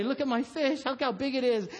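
high-pitched imitation of a boasting kid; proud showing off. Son showing everybody his fish, excited bragging. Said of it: "he's, like, showing everybody".

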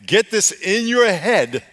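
high, loud, emphatic sermon delivery; full-force preaching. Real, not acted. Preacher pressing the congregation to love God first, full-force preaching. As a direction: drive each point home to the congregation.

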